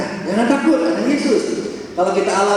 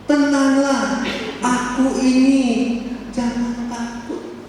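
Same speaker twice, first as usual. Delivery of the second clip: reassuring Jesus; 6 semitones higher, slower than usual. Acted. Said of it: "Jesus voiced again".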